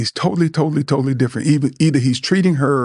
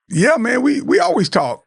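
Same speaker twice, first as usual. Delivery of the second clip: much higher, breezy dismissive buddy; brushing it off; 10 semitones higher than usual. Retold or imitated. A friend dodges a real talk, breezy and dismissive. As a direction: laugh off the serious ask. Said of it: "and go".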